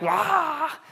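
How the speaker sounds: high-pitched imitated wail; mock panicked scream. Dad imitating his panicking daughter in the water, high mock scream. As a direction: imitate a flailing child's drawn-out "aah". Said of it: "she's going crazy".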